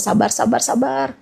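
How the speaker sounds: ordinary talk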